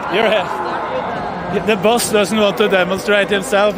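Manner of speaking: ordinary delivery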